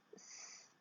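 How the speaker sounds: S as a long voiceless hiss, sss; hissed S sound. Shown as a demonstration. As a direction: hold the pure S sound, no vowel. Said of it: "je vais chanter le son".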